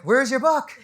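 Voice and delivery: playful public-shaming shout; mock jeering. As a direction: heckle like a crowd demanding proof. Imagined audience jeering the author.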